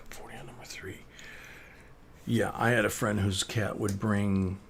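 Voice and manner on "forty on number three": hushed whisper. Real, not acted. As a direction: breathe the number out softly. Repeats the call after laughing.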